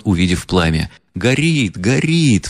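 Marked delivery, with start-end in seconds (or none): 1.2-2.5 two sharp cries, pitched higher; a mild alarmed yell, pitched up; crowd outcry. A narrator's reading. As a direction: cry out in alarm together. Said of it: "вскричали все в один голос".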